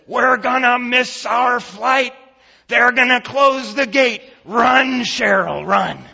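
loud, high, urgent shout; angry yelling. Retold or imitated. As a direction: holler at your wife to hurry for the plane. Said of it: "he keeps yelling".

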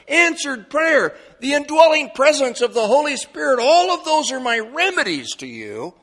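high, loud sermon delivery; full-force preaching. Acted. Preacher summing up promises for troubled hearts, impassioned and loud. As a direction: drive each promise home to the congregation.

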